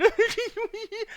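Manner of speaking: high-pitched mimicked giggling fit; imitated helpless laughter. Retelling her high on the kitchen floor, mimicked high laughter. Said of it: "She's literally on the floor going".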